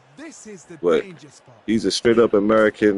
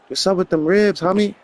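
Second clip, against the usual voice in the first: lightly voiced Chicano homeboy; Chicano homie; 9 semitones higher than usual. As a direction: play at being a laid-back Chicano homie.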